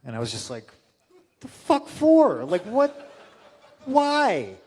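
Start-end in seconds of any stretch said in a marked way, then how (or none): 1.4-4.6 high, loud, slow baffled outburst. Retold or imitated. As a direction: react in loud disbelief. Retold baffled reaction.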